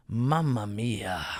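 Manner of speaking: slow, low, soft "Mamma mia"; mock Italian accent. The Italian diner grumbling, in a mock Italian accent. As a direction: sigh it like a cartoon Italian.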